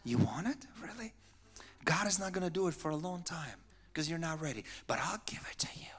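soft, coaxing tempter ending in a whisper; honeyed, hushed persuasion; sweetest voice. Shown as a demonstration. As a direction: offer it sweetly and gently, as if doing a favour. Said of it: "He's the sweetest voice".

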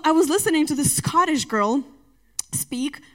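ordinary talk, nothing marked

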